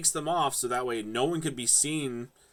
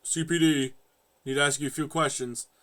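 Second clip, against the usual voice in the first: mock cop; 2 semitones higher than usual. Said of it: "this guy's just watching way too much television".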